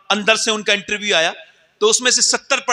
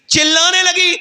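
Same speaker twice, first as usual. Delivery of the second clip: rising cry; 10 semitones higher, 4 dB louder than usual. Acted. Preacher crying out the mother's screams in the fire. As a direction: voice climbs high and loud on the screaming.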